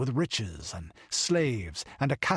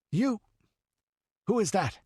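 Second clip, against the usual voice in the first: much higher startled cry; startled demand; 11 semitones higher, 3 dB louder than usual. A narrator's reading. The count hearing the child's footsteps, startled cry. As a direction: snap round at the sound.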